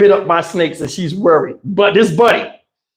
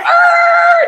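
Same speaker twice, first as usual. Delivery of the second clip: wild yell. Retold or imitated. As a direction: let out a wild yell as you swerve. Dad whips car, yells.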